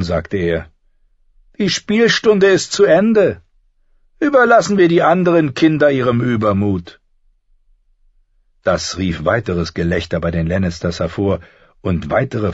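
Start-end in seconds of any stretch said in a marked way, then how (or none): 1.6-7.0 high, affected, dismissive princely voice; feigned boredom. A narrator's reading. Prince leaving the children to play, disdainful. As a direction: dismiss them all in a lofty, high voice. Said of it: "Joffrey täuschte ein Gähnen vor".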